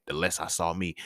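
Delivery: ordinary talk, nothing marked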